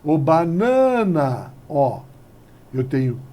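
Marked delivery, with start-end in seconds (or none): 0.0-1.5 banana slowly called, leaning on baNAna; stretched stressed syllable. Shown as a demonstration. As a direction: middle NA of banana held long. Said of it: "É essa daqui que é mais forte".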